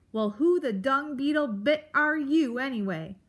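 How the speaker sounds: loud, high, testy grump; sudden demand. Acted. Grump suddenly demands who the mouse is, testy. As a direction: demand it with blustering impatience. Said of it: "Aerith suddenly demanded".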